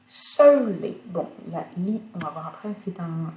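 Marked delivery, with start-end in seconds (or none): 0.1-1.0 "Solely" with the root high and loud; stress on first syllable. Shown as a demonstration. Teacher modelling stress on the root, first syllable of "Solely" stressed. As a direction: punch the first syllable of "Solely", drop the second. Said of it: "ça sera accentué donc sur la première".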